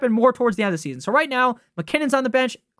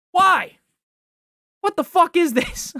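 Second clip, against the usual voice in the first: exasperated outburst; 7 semitones higher, slower than usual. Real, not acted. Outraged at scheduling.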